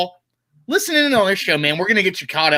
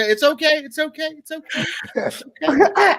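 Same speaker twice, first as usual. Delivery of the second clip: laughing; 9 semitones higher than usual. Real, not acted. Forgiving host's mistake, laughing.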